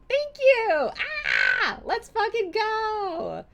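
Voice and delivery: very high squealed celebration; real elation. Real, not acted. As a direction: squeal thanks, then a drawn-out cheer. Celebrating the donation again.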